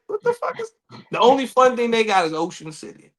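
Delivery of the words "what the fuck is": disbelief. Retold or imitated. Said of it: "I'd be like".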